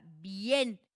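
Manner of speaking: BIEN with the e hit loud; stress on the E. Shown as a demonstration. Teacher shows the tonic syllable, bien loud on the e. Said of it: "¿Dónde recae la sílaba tónica?".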